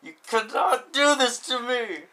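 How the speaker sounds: loud, high, drawn-out outcry; elevated voice. Pretend. Writer told time is up, loud dramatic protest. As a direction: wail it in over-the-top protest. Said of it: "keep your voice, you know, not so elevated".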